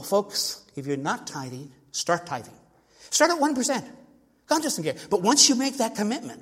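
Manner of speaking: high, urgent preacher's appeal to the flock; fiery exhortation. Retold or imitated. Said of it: "And so I say to people".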